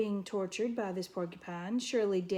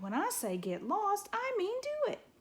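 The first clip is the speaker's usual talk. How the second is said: soaring much higher on the end; furious order; 12 semitones higher than usual. A narrator's reading. Porcupine ordering the fox away, furious. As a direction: shriek the command.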